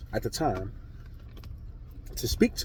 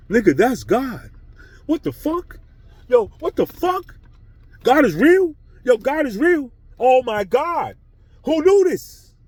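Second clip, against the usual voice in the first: much higher, louder shocked yelling; stunned disbelief; 12 semitones higher, 8 dB louder, slower than usual. Retold or imitated. Realizing God is real, a high shocked yell.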